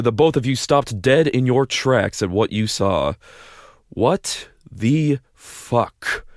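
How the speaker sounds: ordinary talk, nothing marked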